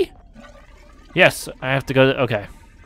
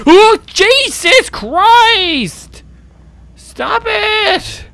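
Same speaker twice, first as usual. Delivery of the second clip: very high loud drawn-out shriek; terrified scream; 11 dB louder, much slower than usual. Real, not acted. Gamer screaming at a ghost to stop. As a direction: scream it, genuinely scared. Jump scare.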